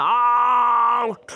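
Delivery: drawn-out mock shout of "आउट!"; cricket appeal shout. Teacher acting players appealing to the umpire, drawn-out shout. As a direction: stretch out "आउट!" like a fielder's appeal. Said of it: "बहुत चिल्लाते हैं".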